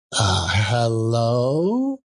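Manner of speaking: put-on slow, unsure boy's reply; hesitant street boy, confused; lightly voiced puzzled urchin.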